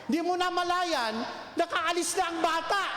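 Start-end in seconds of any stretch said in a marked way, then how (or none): 1.6-2.8 laughing. Real, not acted. Punchline laughed.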